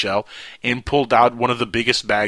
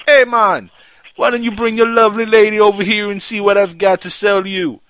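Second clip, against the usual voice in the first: much higher, louder Jamaican call across a fence; Jamaican yell; 10 semitones higher, 7 dB louder than usual. Retold or imitated. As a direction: hail the couple like a beach hustler. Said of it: "he yells from the other side".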